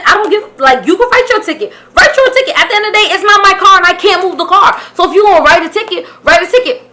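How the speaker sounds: high, insistent retold protest; upset defiance. Upset passenger telling cop to write the ticket, defiant. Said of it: "I was upset".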